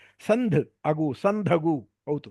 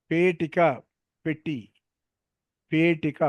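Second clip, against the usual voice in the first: usual speaking voice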